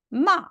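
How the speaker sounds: 마 short, falling sharply from high; fourth tone. Shown as a demonstration. Teacher showing students fourth tone, forceful falling 마. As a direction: push hard and drop 마. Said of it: "삼 성, 사 성".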